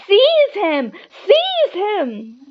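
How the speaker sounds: high loud shriek; villain shrieking at Quirrell. A narrator's reading. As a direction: shriek it with fury. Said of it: "shrieked Voldemort again".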